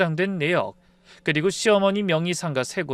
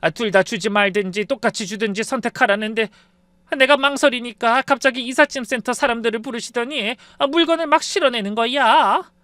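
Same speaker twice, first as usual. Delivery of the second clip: put-on high, rattled older woman, climbing louder; acted shaken voice, pitched up and flustered; trembling mother-in-law; 5 semitones higher, 4 dB louder than usual. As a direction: relay the ordeal in mounting alarm. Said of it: "어머니가 떨리는 목소리로 아빠의 말씀을 전하셨어요".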